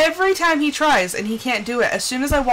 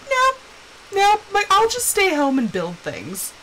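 high, quick "nope" easing down; playfully balk, starting high and dropping to normal; mock refusal; 9 semitones higher than usual. Gamer explaining why friend does the caves, mock refusal. Acting own refusal to go caving.

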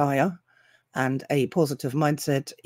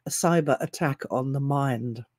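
ordinary talk

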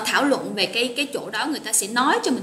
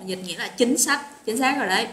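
normal talking voice

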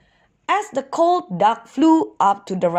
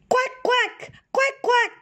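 much higher quacking in a duck voice; duck quacks. A narrator's reading. Ducks calling to each other in flight, high quacks. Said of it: "The ducks quacked to each other".

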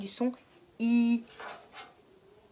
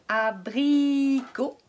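abricot drawn out slowly; slow syllable-by-syllable; 3 dB louder, much slower than usual. Shown as a demonstration. Teacher recaps abricot slowly for the class. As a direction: stretch abricot to show the I. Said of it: "on entend I au milieu".

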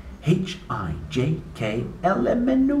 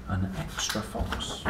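soft slow whisper; 5 semitones lower, 9 dB quieter, slower than usual. Real, not acted. Parent whispering to a child over the picture book.

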